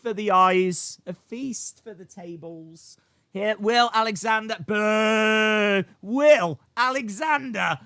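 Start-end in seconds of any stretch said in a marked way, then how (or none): 4.7-7.8 long drawn-out jeer; mock booing. Streamer jokingly booing a donor, drawn-out mock jeer. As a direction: boo slowly and loudly, in jest. Probably shouldn't boo people.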